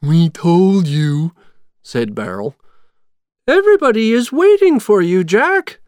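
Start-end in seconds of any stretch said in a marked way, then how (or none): 0.0-1.3 slow, drawn-out, louder henchman voice; smug henchman. A narrator's reading. Barrel chimes in after the others. 3.5-5.8 high, loud, quick and cheery; jubilant, high-pitched mayor voice; happy. A narrator's reading. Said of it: "the mayor said happily".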